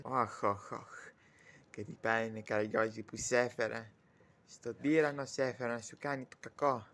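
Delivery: low, sighing self-reproach; regretful lament. Acted. Lamenting bringing Karagiozis to a tyrant, woefully. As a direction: sigh it out low, full of regret.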